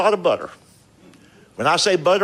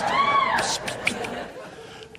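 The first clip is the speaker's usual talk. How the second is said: high mock spray-can psst; spritzing sound, laughing; spray hiss; 8 dB quieter, much slower than usual. Miming butter spray mist.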